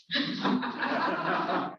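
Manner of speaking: quiet breathy chuckle after the punchline; soft real laugh. Real, not acted. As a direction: chuckle at your own joke.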